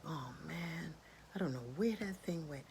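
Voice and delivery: soft, deflated mumble of disappointment; let-down cat. Acted. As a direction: give up quietly, acting disappointed. Cat gives up the hunt.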